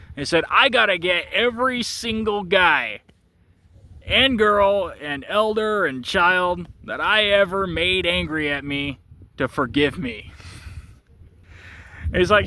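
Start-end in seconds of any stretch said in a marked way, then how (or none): 0.5-10.3 slow, weighty insistence, starting high; emphatic acted vow. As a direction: hammer out each item slowly in a retold plea. Acted vow to win everyone's forgiveness.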